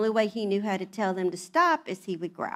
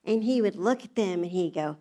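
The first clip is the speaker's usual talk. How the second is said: ordinary delivery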